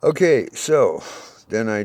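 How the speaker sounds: Okay so stretched slowly, high and loud; loud drawn-out restart. Real, not acted. Man regaining his thread before viewers, loud and slow. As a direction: haul the story back on track loudly.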